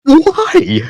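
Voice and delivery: high exasperated why. Real, not acted. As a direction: plead it to the sky in disbelief. Exasperated about the account.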